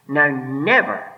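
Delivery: never drawn out slow and loud; stress on never. Shown as a demonstration. Preacher stressing a word to the congregation, slow and loud. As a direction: lean hard on the word never. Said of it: "I emphasize the word".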